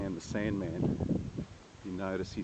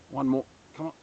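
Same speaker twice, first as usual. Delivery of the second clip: louder, urging himself on; psyching myself up; 5 semitones higher, 7 dB louder, faster than usual. Real, not acted. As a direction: push yourself through the last step. Pushing through sticky mud.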